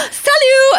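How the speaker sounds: high, loud, bright-eyed Salut; eager greeting. Retold or imitated. Traveller greeting the immigration officer, excited and loud. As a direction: throw out Salut like a proud local.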